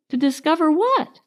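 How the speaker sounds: nervous, asking with unease; a touch of timid worry; anxious. A narrator's reading. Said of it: "said Piglet anxiously".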